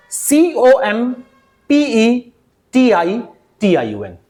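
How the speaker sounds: letter by letter, slow, with gaps; spelling out. Shown as a demonstration. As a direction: spell "सीओएमपीईटीआईटीआईओएन" slowly in letter groups. Teacher spells out the word.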